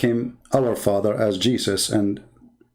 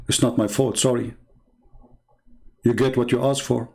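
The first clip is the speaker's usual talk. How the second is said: usual speaking voice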